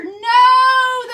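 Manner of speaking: high loud no; alarmed cry. A narrator's reading. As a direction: gasp out in alarm. Big Bark falling.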